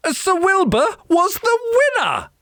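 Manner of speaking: ordinary delivery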